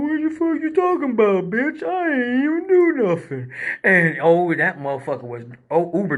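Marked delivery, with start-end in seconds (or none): 0.0-3.5 Droopy-style Dopey Dog. Retold or imitated. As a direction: imitate a dopey cartoon dog, pitched way up. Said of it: "he sounds kinda like, uh, uh, Dopey Dog".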